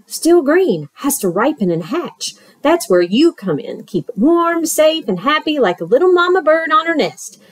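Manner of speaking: lightly voiced smooth-talking fox; sly fox. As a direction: coax him like a friendly huckster. Fox's sales patter.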